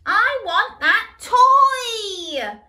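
very high, loud, slow shriek; shrieking demand. Acted. Little brother shrieks for the toy, very high and loud. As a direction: shriek it like a toddler. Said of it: "Matthew shrieked".

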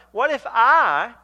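"I" loud, high and stretched out; slow down and hit "I" hard; emphatic stress on I. Acted. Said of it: "and then he emphasizes himself".

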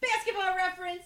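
loud, high announcement; playful high-pitched holler; jokey call-out. Real, not acted. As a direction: sing out the label over the laughter. Jokey call-out amid laughter.

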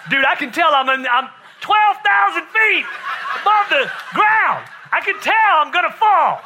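high, loud comic panicked shout; mock-terrified holler climbing high; hammed-up panic. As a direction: yell it like you're about to plummet, for laughs. Acting out panic before the drop.

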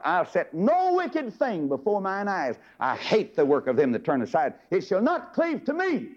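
slow, lifted vow; solemn scripture reading. Acted. Preacher voicing David's vow, slow and solemn. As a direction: read it like a sacred oath.

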